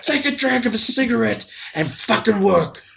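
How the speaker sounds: high, ranting mock boss voice; imitating angry Niels. Mocking the mentor waking him up, high ranting. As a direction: rant like a mentor going nuts. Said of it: "He's, like, going nuts".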